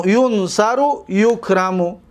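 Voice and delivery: ordinary delivery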